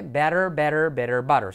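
American flapped t and full final r; quick American "better, butter"; American accent. Shown as a demonstration. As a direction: tap the t's in American English. American takes before British ones.

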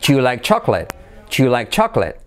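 "Do you" squeezed into one syllable, "ju"; quick contracted "Do you". Shown as a demonstration. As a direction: say "Do you like chocolate" as "Ju like CHAK-let". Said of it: "Do you se convierte en you".

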